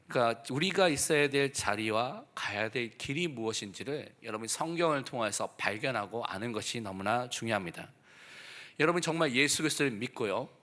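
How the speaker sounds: ordinary talk, nothing marked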